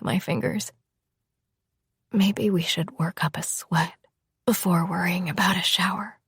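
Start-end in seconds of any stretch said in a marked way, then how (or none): 2.1-6.2 soft, airy suggestive whisper; seductive whisper. Acted. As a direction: tempt him under your breath. Lover's suggestive proposal.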